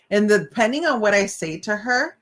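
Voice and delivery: ordinary talk, nothing marked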